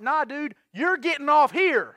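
high, loud, slowing firm refusal; retold getting mad. Driver ordering the hitchhiker out, acted mad. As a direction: dig in and drag out the order. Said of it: "You know, I get mad".

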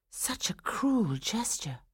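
slow, soft, high whispery hurt; hurt inner thought. A narrator's reading. Swanhilda thinking about Franz's cruelty, hurt and hushed. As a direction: breathe it out slowly, wounded. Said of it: "thought Swanhilda".

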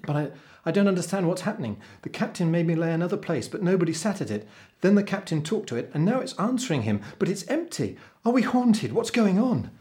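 put-on high, hurried, panicky young voice; spluttering, alarmed boy. A narrator's reading. Boy tells the cook about the ghostly guest, spluttering. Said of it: "spluttered Jake".

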